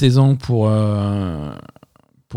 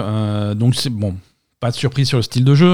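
ordinary delivery

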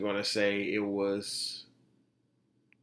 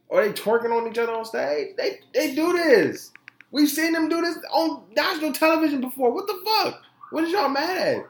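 high, loud exasperated rant; high, loud rant of disbelief; 14 semitones higher, 4 dB louder than usual. Real, not acted.

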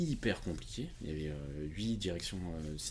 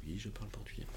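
retold quiet reply; 9 dB quieter than usual. Retelling his quiet answer.

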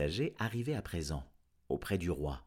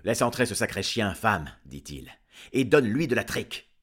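louder, fast irritated farmer; gruff farmer; 3 semitones higher, 8 dB louder than usual. Acted. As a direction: bark orders at his wife. Farmer grumbles at wife. (fermier bourru et agacé, plus fort et rapide)

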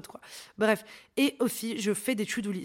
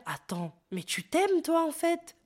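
surprised inner voice; 7 semitones higher, slower than usual. Retold or imitated. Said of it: "ton cerveau va se dire".

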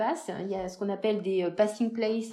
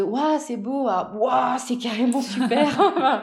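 slow, drawn-out wows with a laugh, a touch louder; mock awestruck wow; 3 semitones higher, 5 dB louder, slower than usual. Road trip scenery getting better and better, mock awed wow. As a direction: replay the growing amazement, half laughing. Mimicking her own awe on the road.

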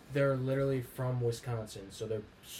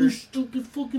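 imitated screaming; 12 semitones higher, 10 dB louder than usual. Imitating a stepdad screaming at the TV, furious yell. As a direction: scream at the TV like an angry fan. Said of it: "my stepdad's screaming at the T V".

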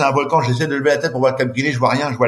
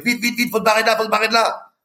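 urgent call; 10 semitones higher than usual. Retold or imitated. Rescuers pulling him away.

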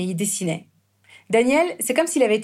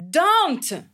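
mock shout; 7 semitones higher than usual. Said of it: "je criais".

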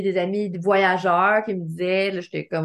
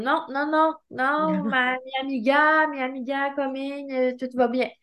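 nervous broken Spanish; 4 semitones higher than usual. Retold or imitated. Refusing stranger, broken Spanish.

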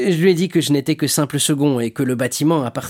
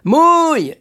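short, high, loud order to the crew; shouted command; 8 dB louder than usual. Acted. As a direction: belt out one drawn-out command. (ordre crié à l'équipage)

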